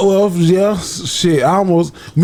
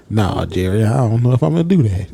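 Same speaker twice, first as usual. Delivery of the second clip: much lower mock voice; Terrence Howard; 10 semitones lower than usual. Co-host mocking his friends' hesitant answer, low impression. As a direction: drop way down, teasing imitation. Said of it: "Both of y'all sound like Terrence Howard".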